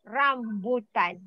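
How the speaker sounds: Rambutan slow, with a clear R at the start; clear R sound. Shown as a demonstration. Teacher drilling Chinese students on capital R, Rambutan with a clear initial R. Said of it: "practice your capital, capital letter R".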